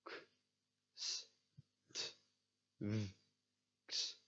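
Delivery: "B, s, k, d" as bare sounds, not letter names; phonics-style sounds from "B" through "x"; isolated consonant sounds. Shown as a demonstration. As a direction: sound out each consonant alone, with pauses. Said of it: "pronounce them".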